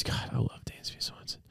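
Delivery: breathless whispered aside; whispering. Real, not acted. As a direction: hush it like a secret crush. Adoring aside about a player.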